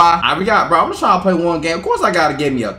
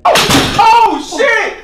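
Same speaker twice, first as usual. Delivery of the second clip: high, startled yelp; shocked squeal; 4 dB louder, much slower than usual. Real, not acted. Gamer caught by a crossover, yelping in high shock. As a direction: let it fly out high and sudden. Gets ankles broken in game.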